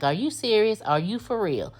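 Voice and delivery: disbelief. Retold or imitated. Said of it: "in disbelief".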